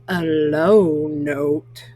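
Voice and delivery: deep, slow voice acting out a low note. Shown as a demonstration. Narrating a low sung note.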